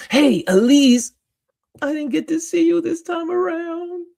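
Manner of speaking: high-pitched, stretched-out greeting; calling out greeting. Real, not acted. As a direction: call out to her way up high. Calling out to an absent viewer.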